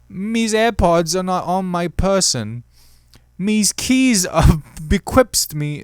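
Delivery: slow, high British read breaking into laughter; stuffy Brit, cracking up; silly British voice, laughing; mock British accent. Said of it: "get into a British accent".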